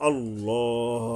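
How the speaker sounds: আল্লাহ with a rounded, held aw, not aa; rounded heavy vowel. Shown as a demonstration. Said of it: "আকার হবে না". (আল্লাহ মোটা স্বরে, এক আলিফ টেনে)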